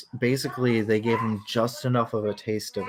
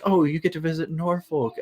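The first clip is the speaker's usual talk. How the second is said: higher, imitating her own naive delight; mock excitement; 8 semitones higher than usual. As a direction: play your younger self thrilled at the news. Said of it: "I was just like".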